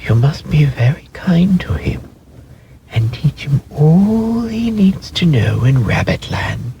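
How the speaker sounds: gentle fairy voice in a high whisper. Acted. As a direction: breathe it like a kind nursery fairy. Fairy speaks to wild rabbits.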